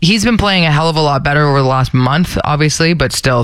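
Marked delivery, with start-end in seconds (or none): none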